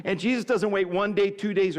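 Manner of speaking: ordinary delivery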